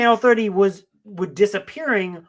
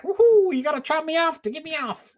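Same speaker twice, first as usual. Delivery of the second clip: giddy character voice; 9 semitones higher than usual. Retold or imitated. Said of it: "you're just like".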